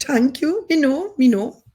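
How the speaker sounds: high, playful Caribbean lilt; Caribbean accent. Pretend. Speaker thanks audience for praising it, playful Caribbean accent. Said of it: "Thank you for saying it's a good accent".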